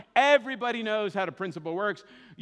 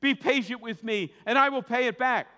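begging; 6 semitones higher, 5 dB louder than usual. A narrator's reading. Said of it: "begged him".

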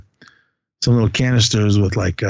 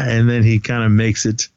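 ordinary delivery

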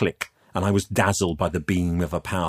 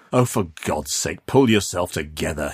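mildly exasperated scolding; weary, impatient telling-off. Acted. Man tells wailing youth to compose himself, exasperated.

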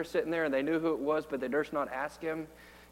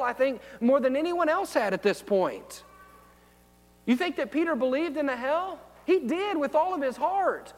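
higher, louder preacher's push; preaching with rising fervour; fervent preaching; 8 semitones higher, 5 dB louder than usual. Acted. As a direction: drive it home from the pulpit. Preaching about hell.

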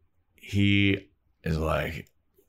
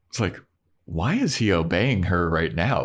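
normal talking voice

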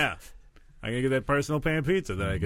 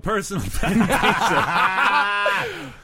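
louder, slow, laughing plea; 8 semitones higher, 6 dB louder, slower than usual. Real, not acted. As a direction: beg while cracking up.

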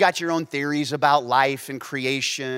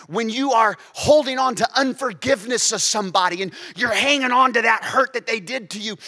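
rising, loud preaching build; 9 semitones higher, 7 dB louder than usual. Acted. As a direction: preach it with growing fire. Preaching at full force.

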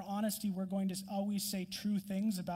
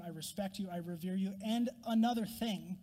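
usual speaking voice

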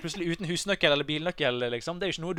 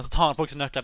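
ordinary talk, nothing marked

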